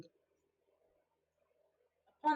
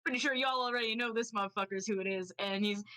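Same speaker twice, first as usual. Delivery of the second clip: usual speaking voice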